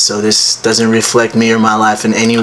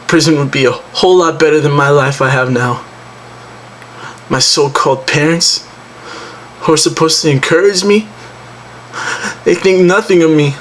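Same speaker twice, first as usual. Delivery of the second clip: higher-pitched, indignant complaint about the parents; mildly bitter dramatic monologue; acted bitterness; 5 semitones higher than usual. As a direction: vent hurt resentment, pitch climbing. Acted monologue, resenting his parents.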